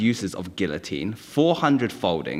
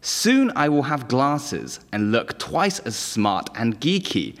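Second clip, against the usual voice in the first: usual speaking voice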